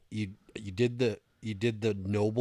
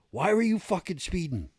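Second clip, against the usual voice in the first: higher, louder imitation of an indignant trooper; retold demanding trooper; 11 semitones higher, 5 dB louder than usual. State trooper confronts a speeding driver at his car, demanding. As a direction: bark the trooper's question, voice pitched up. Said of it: "Just like that".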